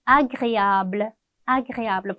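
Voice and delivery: agréable drawn out slowly, "a-gre-a-bl"; teacher stretches agréable very slowly, syllable by syllable; slow French model. Shown as a demonstration. Teacher to class, slowly modelling agréable. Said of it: "유쾌한".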